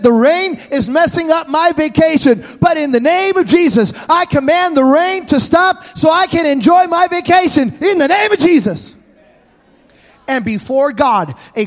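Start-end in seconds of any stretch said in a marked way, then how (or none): 0.0-8.9 put-on higher, bold child's command; boy commanding rain. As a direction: order the rain away like a boy. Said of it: "He said".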